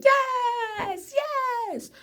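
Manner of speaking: very high squealed yes, yes; a thrilled squeal of delight; excited cheer. Real, not acted. Rooting for the couple, a high excited cheer.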